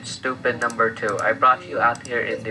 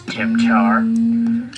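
high loud creature call; creature cry; 11 semitones higher, 7 dB louder than usual. Acted. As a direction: shout the name like a Pokemon. Creature says its name.

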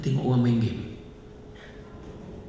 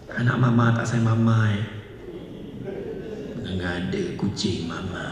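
coaxing mother; 6 semitones lower, 5 dB quieter than usual. Pretend. The mother sweet-talking her child, soft and low. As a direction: coax the child tenderly. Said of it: "Kita ubah approach".

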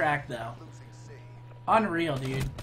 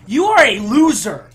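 loud angry taunt at a rival; 4 semitones higher, 13 dB louder than usual. Real, not acted. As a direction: shout it like a sore gamer. Raging at opponent.

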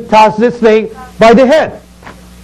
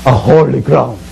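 voice of God; 10 semitones lower than usual. Acted.

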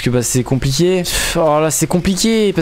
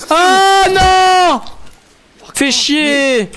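loud high yell of frustration; frustrated shout; 4 dB louder, much slower than usual. Real, not acted. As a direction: shout it out, exasperated. Stung by insect in game.

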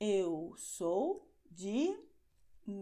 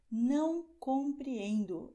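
slow, clear delivery, stress on não; teacher modelling a useful phrase; slow model phrase; 3 semitones higher, 4 dB louder, slower than usual. Shown as a demonstration. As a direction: say it slowly for learners. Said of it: "é o que você diz".

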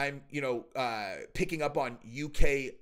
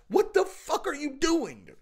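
high, drawn-out exasperated disbelief; incredulous; 10 semitones higher than usual. Real, not acted. Scolding a wife for her reckless advice, incredulous. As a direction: stretch it out in appalled disbelief.

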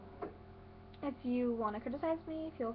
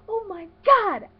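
gasp, then loud, high, drawn-out shock; hammed-up scandalized outburst; mock horror; 8 semitones higher, 12 dB louder, slower than usual. Older cousin reacting to a swearing confession, mock horror. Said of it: "I look at her and I go".

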